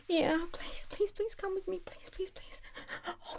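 breathy pleas dying away; fading whispered begging. Acted.

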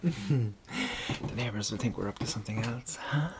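hushed conspiratorial whisper. Acted. Keeping it down for the neighbors, a hushed whisper. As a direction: whisper so the neighbors won't hear.

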